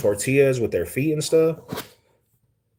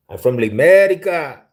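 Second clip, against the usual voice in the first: loud, high and proud, belting it out; proud shout; 7 semitones higher, 7 dB louder than usual. Real, not acted. Streamer answering viewers, proudly shouting he's from America. Proudly announcing home country.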